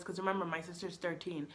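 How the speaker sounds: ordinary delivery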